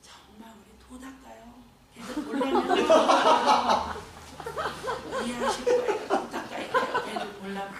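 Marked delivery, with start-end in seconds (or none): 1.9-2.8 laughing. Real, not acted. Laughing at her own joke. 2.8-5.2 big burst of loud, high laughter; a loud, helpless laugh. Real, not acted. Laughing at her own joke. 5.2-7.7 laughing. Real, not acted. Still laughing after joke.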